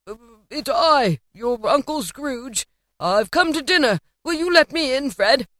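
put-on humble old uncle asking to be let in; Scrooge, hopeful. A narrator's reading.

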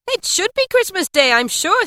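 high, loud scornful retort; sarcastic wife. A narrator's reading. Mrs Cratchit answering Bob, loud sarcasm. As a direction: sneer at the very idea.

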